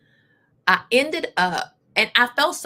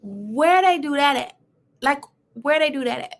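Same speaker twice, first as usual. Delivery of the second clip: high, louder, incredulous where they do that at; indignant disbelief; 6 semitones higher, 3 dB louder than usual. Real, not acted. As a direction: ask it like you can't believe it. Outraged rhetorical question.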